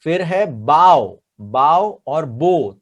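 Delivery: बाव said "bau", then बो as "bo", slowly; slow, clear बाव then बो; bow two ways. Shown as a demonstration. Teacher slowly showing students बाव and बो. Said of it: "bow and arrow वाला बो".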